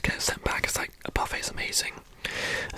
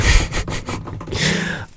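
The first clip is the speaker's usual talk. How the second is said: whispered laugh; 9 dB louder than usual. Real, not acted. Laughs at own joke.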